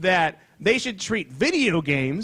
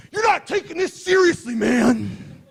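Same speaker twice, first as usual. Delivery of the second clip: hammed-up indignant player; mock angry gamer. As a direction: imitate a gamer who is taking it too seriously. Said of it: "getting really mad at me".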